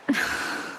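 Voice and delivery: soft weary exhale; tired sigh. Acted. Ailing girl sighs to herself, weary. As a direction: let out a tired breath.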